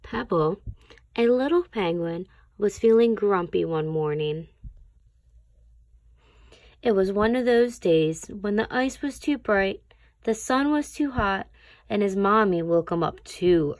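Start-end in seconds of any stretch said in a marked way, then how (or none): none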